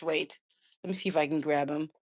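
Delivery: ordinary talk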